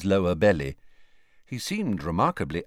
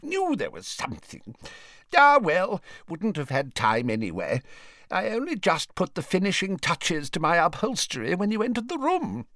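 higher-pitched wizard voice, rising as he goes; put-on character voice for a caught-out wizard; rueful old wizard; 9 semitones higher than usual. A narrator's reading. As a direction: grumble to yourself, climbing high. Voices Slughorn talking to Dumbledore.

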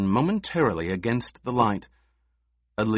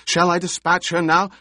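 shouting; 8 semitones higher, 6 dB louder, faster than usual. A narrator's reading. Baron still shouting.

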